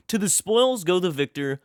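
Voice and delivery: usual speaking voice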